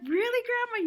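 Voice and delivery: high, bright eager question to grandma; a little girl thrilled at Grandma's words; excited child. Retold or imitated. Little girl excited as her grandma talks back in Mohawk. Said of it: "I'd be so excited".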